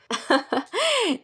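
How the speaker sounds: a short, high-pitched laugh; light laugh. A narrator's reading. Grandmother laughing off the nurse's suspicion, with a short laugh.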